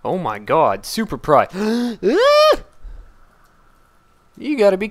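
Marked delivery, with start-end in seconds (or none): 2.0-2.6 sudden high loud shriek; startled scream. Real, not acted. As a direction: scream out in shock.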